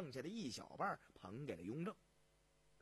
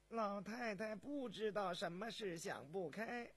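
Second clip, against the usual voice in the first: high, slow palace servant; high, drawn-out deferential voice; servant revealing bad news; 5 semitones higher, slower than usual. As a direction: confess it haltingly to the emperor.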